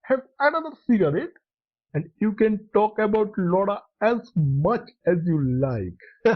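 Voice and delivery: usual speaking voice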